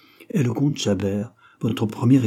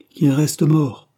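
louder, higher and slow, cutting in; sharp, lively interjection; 6 semitones higher, 6 dB louder, much slower than usual. A narrator's reading. Derville interrupting the countess, sharp and lively. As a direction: snap the words out to cut her off. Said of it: "dit vivement Derville".

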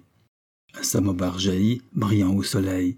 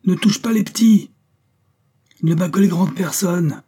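high, loud urgent yell; pitched-up full-voiced shouting; howled protest; 10 semitones higher, 6 dB louder than usual. A narrator's reading. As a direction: shout to hold the mob back. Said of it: "hurla quelqu'un".

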